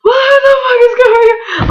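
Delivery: very high, hammed-up wail; imitated sobbing freak-out squeal; crying. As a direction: wail it like a tearful panic. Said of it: "I just started crying".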